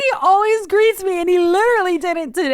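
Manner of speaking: high, loud mock-distraught whine; hammed-up wounded complaint; mess on the phone. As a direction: plead it as if near tears. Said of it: "I was a mess on the phone".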